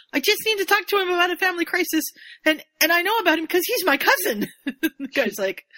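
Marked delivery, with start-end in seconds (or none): 0.1-4.5 fast and higher, flustered. Retold or imitated. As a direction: rush it out in a panic. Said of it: "Dudley's like".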